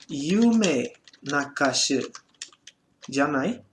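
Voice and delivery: "Yume Nakashi janai" drawn out very slowly; slow dictation. Shown as a demonstration. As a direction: read it slowly for the class to copy. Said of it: "Então, era". (leitura lenta e pausada)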